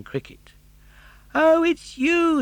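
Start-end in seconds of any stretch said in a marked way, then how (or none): 1.3-2.4 very high, slow, louder surprise; a drawn-out startled recognition; surprised Pinocchio. Acted. Pinocchio surprised to see cricket.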